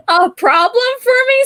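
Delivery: high, loud, laughing through the words; real laugh bursting into the speech; amused laughing. Real, not acted. Artist admitting she was the shortest in class, laughing.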